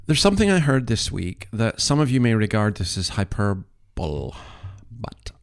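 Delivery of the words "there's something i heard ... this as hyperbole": ordinary delivery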